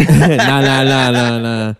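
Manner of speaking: real laughing protest, falling away; laughing denial. Real, not acted. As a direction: laugh it off while waving away the suggestion. Laughing off a cheeky interjection.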